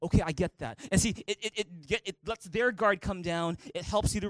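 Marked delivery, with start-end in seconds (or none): 0.0-0.8 lower, softer, quick settling okay; calm understanding. Retold or imitated. The loved one, calmed, a lower softer acceptance. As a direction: let your guard drop and agree. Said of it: "and they'll be".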